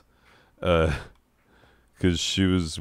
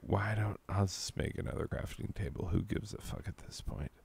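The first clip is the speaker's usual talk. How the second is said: much softer, fast muttering aside; muttered to himself; 14 dB quieter, faster than usual. Real, not acted. Gamer gives up on a plan, muttering softly and fast. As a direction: grumble it under your breath.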